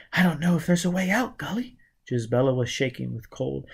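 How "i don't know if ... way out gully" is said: put-on scared young woman. A narrator's reading. Gisbella's voice.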